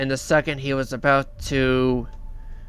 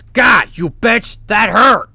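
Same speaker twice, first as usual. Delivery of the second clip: slow, higher, louder furious yell; angry shout; 7 semitones higher, 7 dB louder, slower than usual. Retold or imitated. Button scratched in the eye, shouting at Kat. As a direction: spit it out in hammed-up pain and fury. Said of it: "She goes".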